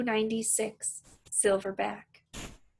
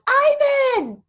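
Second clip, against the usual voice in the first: high, loud call across the zoo; yell; 10 dB louder than usual. Acted. Julia calling Ivan across the moat, loud yell. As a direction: shout his name to be heard far off. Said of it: "the girl yells".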